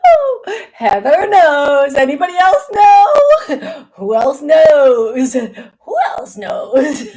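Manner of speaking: loud, high words through laughter; real uncontrollable laughing fit; hysterical laughter. Real, not acted. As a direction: shout it while cracking up, stretching the words. Laughing fit over Heather knowing.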